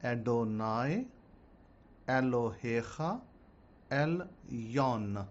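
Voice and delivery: Adonai, Elohecha, Elyon each spoken slowly as a separate word with pauses between. Shown as a demonstration. Teacher giving listeners the Hebrew prayer, slow and word by word. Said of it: "Okay, I'll say it slowly".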